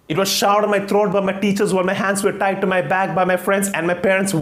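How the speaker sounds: ranting student candidate. Acted. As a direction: pour out a pent-up grievance at the interviewer. Candidate rants in monologue. (भड़का हुआ छात्र, शिकायत भरे लहजे में)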